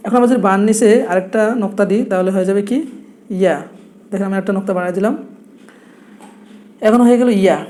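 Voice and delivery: ordinary delivery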